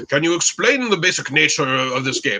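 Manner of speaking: elderly Briton in a put-on English accent; terrible British accent. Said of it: "I will do my terrible British accent".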